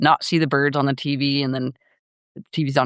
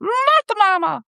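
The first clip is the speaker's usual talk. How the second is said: put-on very high, slow, stretched-out baby voice; slower than usual. Shown as a demonstration. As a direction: try the baby's catchphrase up high. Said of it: "I don't know if I can do the baby voice".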